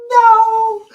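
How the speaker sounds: a high, loud, drawn-out no of dread; panicked no. Retold or imitated. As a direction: yelp it in mock horror. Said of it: "And I'm like".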